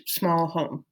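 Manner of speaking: ordinary delivery, nothing marked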